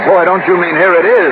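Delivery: shouting over rain. Acted. Shouting over a sudden cloudburst.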